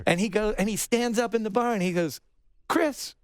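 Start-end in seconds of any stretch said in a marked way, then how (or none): 2.7-3.2 high call of a name across a bar. Retold or imitated. [name] stands up and calls across the bar, high. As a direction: sing out his name to get his attention.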